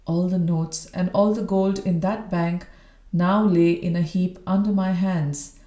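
ordinary delivery

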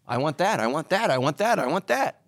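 lightly voiced demanding kid, rising endings; begging child. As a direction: repeat it like a pestering child. Imitating kids begging in store.

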